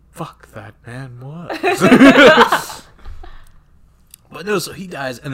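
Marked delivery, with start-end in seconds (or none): 0.1-2.8 suddenly very loud, drawn-out blurt; bewildered outburst. Retold or imitated. Kirk asking Bones about the dead man, loudly baffled. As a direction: burst out in comic bafflement.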